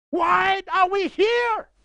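shouting from the pulpit, fired up. Real, not acted. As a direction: hurl the question at the congregation. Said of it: "I feel like I'm screaming at you".

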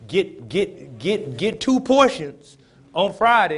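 loud, high preaching cry, stretched slow; full-force preaching. Acted. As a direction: thunder the scripture out over the congregation.

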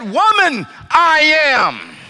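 normal talking voice

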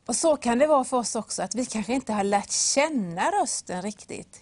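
ordinary talk, nothing marked

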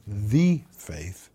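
THE drawn out and punched before "faith"; stress on THE. Shown as a demonstration. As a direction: hit "The" hard as the definite article. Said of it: "It's a definite article".

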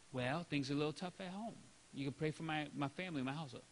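subdued reply. Retold or imitated. Congregant sharing home trouble.

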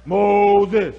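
loud, drawn-out divine call; booming God voice. Retold or imitated. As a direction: call him from the bush like God. Voice of God from bush.

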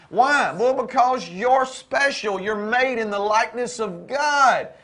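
building, fervent preacher's cadence; impassioned preaching. Real, not acted. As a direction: drive each phrase home to the congregation. Preaches at full force.

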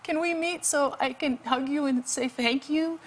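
imitated weak, high voice. Rescued man phoning to thank his rescuer, imitated weak voice. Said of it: "this weak voice".

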